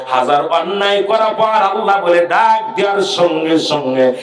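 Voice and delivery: loud, drawn-out waz chanting; full-force preaching. Real, not acted. Preacher telling the crowd of Adam's weeping, full-force preaching. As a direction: stretch the words out in an impassioned sermon cadence.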